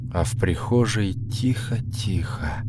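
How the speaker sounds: hushed, slow whisper. A narrator's reading. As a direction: breathe it out unvoiced, tense. Narrator whispers the silence. (шёпотом, медленно)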